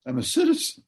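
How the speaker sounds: defiant. Retold or imitated. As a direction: toss it off like nobody can touch you. Wife's defiant quote, retold.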